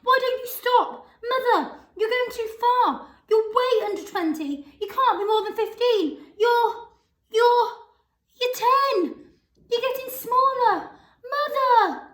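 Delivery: high, loud, alarmed pleading; shrill alarm. A narrator's reading. Missus Bucket begging her shrinking mother to stop, high alarm. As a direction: plead high with rising panic. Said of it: "a shrill note of alarm in her voice".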